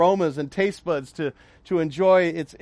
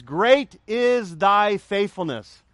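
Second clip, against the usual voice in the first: slow scripture reading; much slower than usual. Acted. Quoting scripture slowly.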